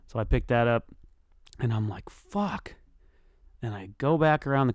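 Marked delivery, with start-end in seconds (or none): 2.1-2.8 small high-pitched curse; frustrated curse. Retold or imitated. Owner finds his bike lock cut, frustrated. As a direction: squeak out a retold curse, softly.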